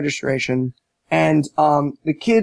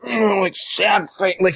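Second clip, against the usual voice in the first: ordinary talk, nothing marked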